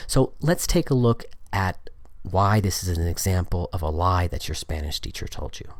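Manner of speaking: usual speaking voice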